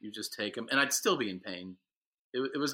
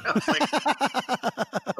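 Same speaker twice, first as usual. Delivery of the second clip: laughing through the words, pitched high; 6 dB louder, much slower than usual. Real, not acted. Retelling the withdrawal nurses while laughing, pitched high. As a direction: break into laughter mid-story.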